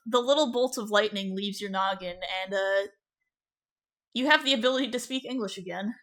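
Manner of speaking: usual speaking voice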